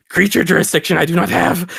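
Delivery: high, strained elf voice; little elf creature. Acted. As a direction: plead like a small creature being shaken. Shaken elf answers in character.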